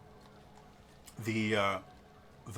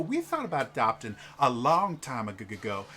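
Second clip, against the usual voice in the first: somewhat higher, rambling lady's voice; chatty noblewoman; 7 semitones higher, 5 dB louder than usual. Acted. A chatty noble wife oversharing with strangers, lightly raised voice. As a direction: ramble on about her own upbringing.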